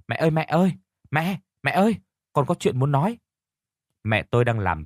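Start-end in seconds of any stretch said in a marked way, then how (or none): 0.1-3.2 high-pitched shout for mother; calling out urgently. Acted. Child running up to mother from afar, shouting. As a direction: call out high and insistent, a child with news. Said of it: "Tôi chạy từ xa đến hét lên".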